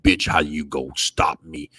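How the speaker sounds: low cocky mock voice; Wilder's look. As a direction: drop low and smug. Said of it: "Wilder looked at him like".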